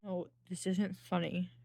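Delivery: ordinary delivery, nothing marked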